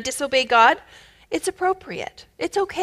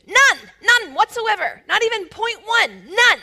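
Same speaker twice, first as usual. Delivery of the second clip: fervent high-pitched insistence; emphatic none; 9 semitones higher than usual. Real, not acted. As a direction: hammer home "None" each time. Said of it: "talking emphatically".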